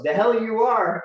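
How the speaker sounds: loud, high outraged snap; mom's angry refusal. Retold or imitated. Mom refusing to let her son enlist, loud furious retort. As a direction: shut him down flat out.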